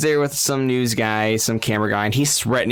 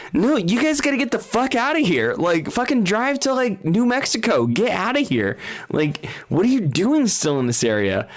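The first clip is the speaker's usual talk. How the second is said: high, pressing mock urgency; high-pitched imitated panic; urgent warning; 8 semitones higher than usual. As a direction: shoo them away, half laughing.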